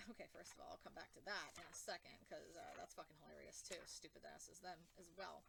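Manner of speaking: low, soft muttered aside. Real, not acted. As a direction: drop into a side comment. Quiet aside mid-rant.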